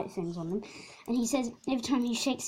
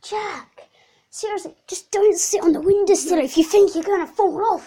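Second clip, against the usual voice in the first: raised, higher voice telling him off; exasperated scolding; 6 semitones higher, 8 dB louder than usual. Real, not acted. Older brother scolding Jack about the windowsill, loud and exasperated. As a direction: scold your little brother sharply.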